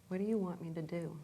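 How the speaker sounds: quietly putting it to an inner voice; softly to oneself. Real, not acted. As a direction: ask it softly, turned inward. Repeats question to her inner voice.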